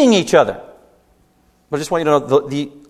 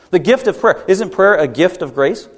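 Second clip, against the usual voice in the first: ordinary talk, nothing marked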